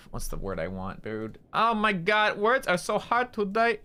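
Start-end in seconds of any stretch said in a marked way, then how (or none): 1.5-3.8 high-pitched frustrated whine; exasperated. Real, not acted. Streamer can't find the word, whining in exasperation. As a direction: complain in mock despair.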